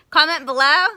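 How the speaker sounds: below said loud with a rise. Shown as a demonstration. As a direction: shout Comment below, rising on below. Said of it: "Is that what we do?".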